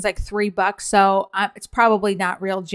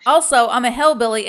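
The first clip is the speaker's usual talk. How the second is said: usual speaking voice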